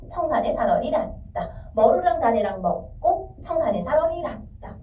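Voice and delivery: measured reading, "청산에 / 사러리 / 났다" in separate beats; deliberate pauses between "청산에", "사러리" and "났다"; three-beat phrasing. Shown as a demonstration. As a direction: break each line in three, "머루랑 / 다래랑 / 먹고". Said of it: "이렇게 끊어 읽을 거래".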